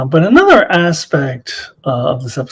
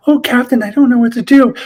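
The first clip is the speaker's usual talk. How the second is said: higher, helpless damsel parody; mock frail woman; 9 semitones higher, faster than usual. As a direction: play the fainting helpless lady, hammed up. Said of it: "the weak and frail woman".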